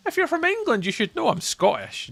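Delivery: exasperated Scot correcting English viewer; exasperated high retort. Real, not acted. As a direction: pitch jumps up in disbelief.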